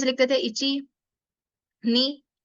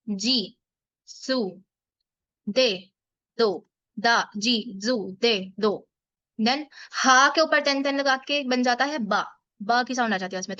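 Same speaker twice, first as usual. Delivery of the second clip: ordinary talk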